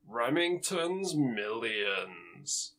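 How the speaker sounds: slow, drawn-out title reveal; long, lingering announcer delivery; segment title announcement. Pretend. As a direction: stretch it out like a show jingle, softly. Introducing a named show segment.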